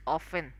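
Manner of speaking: "often" pronounced "offen"; silent T. Shown as a demonstration. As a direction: drop the T in "often". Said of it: "the sound of that word is".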